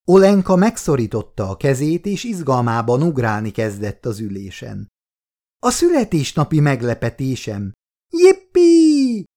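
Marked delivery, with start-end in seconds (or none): 5.6-9.2 much higher, louder squeal of joy; a little girl bouncing with delight; girl squealing at her birthday surprise, high and loud; excited child cry. Acted. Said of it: "kiáltott fel".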